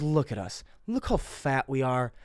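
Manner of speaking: soft, slowing imitation of a buddy; buddy's deflated remark. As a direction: undercut the movie moment quietly. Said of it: "no, not for us".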